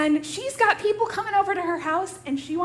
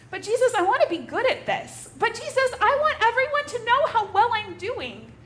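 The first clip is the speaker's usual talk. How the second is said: pleading Martha; 7 semitones higher, 4 dB louder than usual. Acted.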